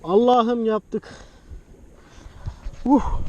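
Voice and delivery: overjoyed pilot after landing; jubilant relief. Real, not acted. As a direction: savour the win out loud. Celebrating successful flight.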